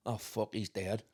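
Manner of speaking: deadpan. Retold or imitated. Unsympathetic friend deadpan.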